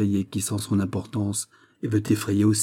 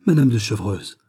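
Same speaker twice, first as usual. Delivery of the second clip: louder surprised cry of a name; queen cries out in recognition; cried out; 4 dB louder than usual. A narrator's reading. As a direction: call the name out in shock. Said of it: "s'écria la reine".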